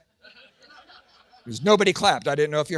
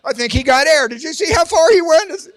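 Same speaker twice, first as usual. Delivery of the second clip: high, loud excited onlooker; hammed-up amazed neighbor; commenting neighbors; 7 semitones higher, 8 dB louder than usual. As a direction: marvel loudly like an impressed spectator. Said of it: "Neighbors would comment".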